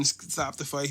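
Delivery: normal talking voice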